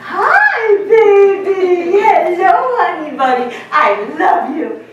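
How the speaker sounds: put-on high, lilting baby talk, easing down at the end; sing-songy baby talk. Shown as a demonstration. Lecturer shows the class how we talk to babies, high sing-song baby talk. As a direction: coo at an infant like a doting parent. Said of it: "when we are interacting with babies".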